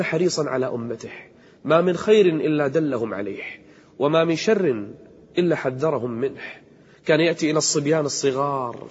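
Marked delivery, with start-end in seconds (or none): none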